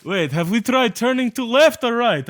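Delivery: put-on confused Russian-accented worker. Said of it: "Where this just Russian accent".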